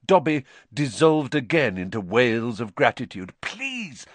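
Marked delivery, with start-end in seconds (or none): 3.4-4.1 high, hushed plea; soft, desperate, pitched-up please; frantic whisper. A narrator's reading. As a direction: beg urgently under the breath. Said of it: "Harry whispered frantically".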